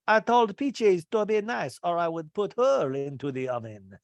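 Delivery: playful French chef threat; mock French chef. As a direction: chef joking about the dog, light French accent. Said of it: "he's talking French".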